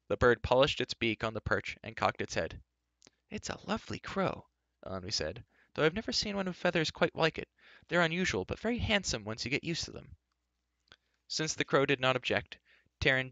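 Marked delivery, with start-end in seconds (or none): 3.3-4.5 high, soft character voice; Elenby, delighted admirer. Acted. Elenby admiring Gwistle's crow, softly delighted. 5.7-10.2 high-pitched character voice, admiring; Elenby, delighted admirer. Acted. Elenby admiring the crow's unusual feathers, high and pleased.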